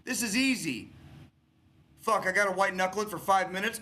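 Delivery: fired-up pep talk to struggling vets, rising to a peak; rising impassioned rant. Real, not acted. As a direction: pour it out, climbing in urgency.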